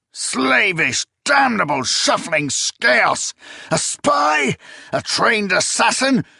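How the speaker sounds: a furious emperor lashing out at the traitor; raging tirade. A narrator's reading. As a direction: spit each insult at the absent spy.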